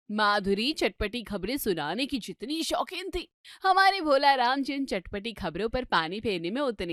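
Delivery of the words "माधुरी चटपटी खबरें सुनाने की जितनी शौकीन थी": normal talking voice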